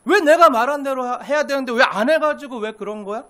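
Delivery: loud acted grievance; resentful reproach. Preacher voicing a believer resenting those who won't comply, angrily reproachful. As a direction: complain hotly, as if blaming someone. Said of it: "원망스러워지는 거예요".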